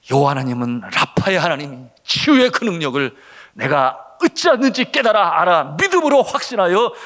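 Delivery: prayer building loud and high; impassioned re-enacted prayer; earnest supplication. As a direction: plead with mounting fervour. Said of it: "주님 앞에 간절히 간구했던 것은".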